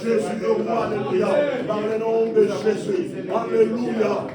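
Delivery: loud, sustained chant-like praying; fervent prayer in tongues. Real, not acted. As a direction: pour out syllables on one insistent level.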